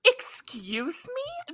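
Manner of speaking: slow, whispered mock outrage; mock offended whisper. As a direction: gasp it out, pretending shock. Mock offense at stoned claim.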